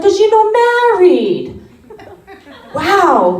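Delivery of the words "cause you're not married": grandma voice. Retold or imitated. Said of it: "She's like".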